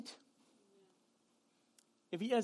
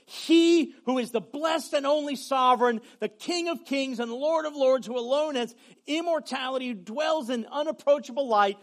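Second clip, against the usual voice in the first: reverent recitation; 5 semitones higher, 11 dB louder than usual. Acted. Recites the doxology.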